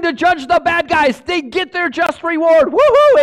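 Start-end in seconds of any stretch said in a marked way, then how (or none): 2.7-3.2 a loud, high whoop of joy; big celebratory woohoo; excited cheer. Acted. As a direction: cheer like a fan at a game. Preacher cheering judgment day.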